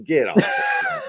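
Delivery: high yelped get off; mock shout. Teasing a friend about [name], yelped get off. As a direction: yelp it in a sudden high squeak. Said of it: "mock shout at friend".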